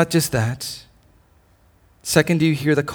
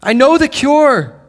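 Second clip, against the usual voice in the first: proclaiming; 9 semitones higher, 7 dB louder than usual. Retold or imitated. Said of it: "he's saying".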